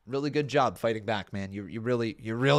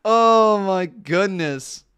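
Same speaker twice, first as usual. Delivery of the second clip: laughing; 6 semitones higher, 10 dB louder, much slower than usual. Real, not acted. Reacting to old video.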